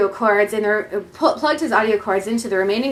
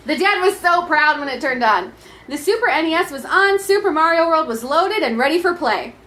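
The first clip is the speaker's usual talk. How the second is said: ordinary talk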